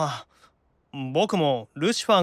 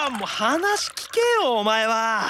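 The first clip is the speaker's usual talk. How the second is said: exasperated; 3 semitones higher, slower than usual. Acted. Levi exasperated Beel ignores him.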